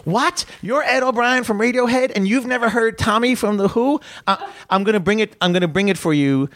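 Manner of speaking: high-pitched mock disbelief; astonished fan, voice leaping way up; incredulous. Excited fan to a famous musician, high incredulous outburst. Acts out his astonished reaction.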